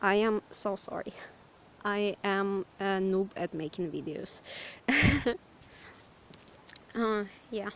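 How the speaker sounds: ordinary delivery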